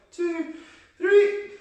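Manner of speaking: tiny, very high-pitched voice; squeaky playful count. Pretend. Coach counting the last spider run, squeaky playful voice. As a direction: pipe the numbers way up high.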